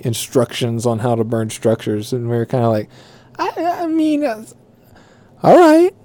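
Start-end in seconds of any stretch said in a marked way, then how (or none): 3.4-5.9 squeaky high, bewildered hesitation; baffled. Retold or imitated. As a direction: go way up, unsure how to answer. Coworkers baffled by arson question.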